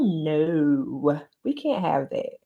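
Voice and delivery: ordinary talk, nothing marked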